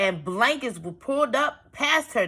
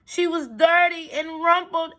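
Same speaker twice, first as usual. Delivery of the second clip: plain reading voice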